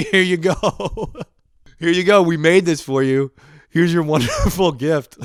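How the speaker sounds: high, loud, laughing "ta-da"; mock delighted presenting. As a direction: imitate gleeful gift-givers, breaking into laughter. Coworkers gleefully presenting garbage gift, acted.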